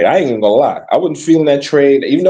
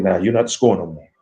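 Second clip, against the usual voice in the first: mock voice, lower, softer, fast; 11 semitones lower, 5 dB quieter, faster than usual. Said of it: "But then he came to the Knicks".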